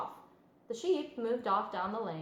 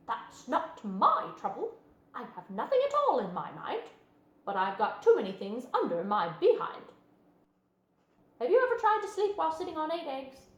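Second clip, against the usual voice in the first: goose voice; 4 semitones higher, 4 dB louder than usual. A narrator's reading. Goose character complaining about eggs.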